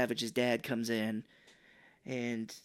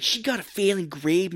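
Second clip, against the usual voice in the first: mock angry; 6 semitones higher, 6 dB louder than usual. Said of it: "he's like".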